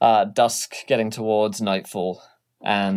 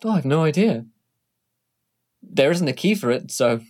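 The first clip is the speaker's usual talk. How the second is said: light secretary voice; 5 semitones higher, faster than usual. Acted.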